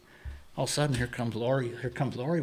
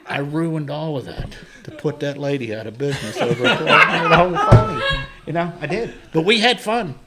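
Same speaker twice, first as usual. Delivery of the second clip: laughing; 4 semitones higher, 12 dB louder than usual. Real, not acted. Laughing at his own folly.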